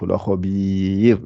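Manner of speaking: lakhabir said slowly with a thin, light ra and the tongue forward; thin ra demo. Shown as a demonstration. Teacher showing students the thin ra, slow careful demo. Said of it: "la lettre n'est pas gonflée".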